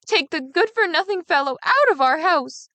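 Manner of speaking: loud screams. Acted. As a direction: scream the news at the father. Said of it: "with loud screams".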